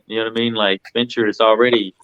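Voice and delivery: normal talking voice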